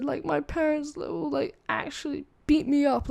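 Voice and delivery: high, put-on pleading voice; tearful pleading. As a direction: imitate a scared friend near tears. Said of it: "tearing up".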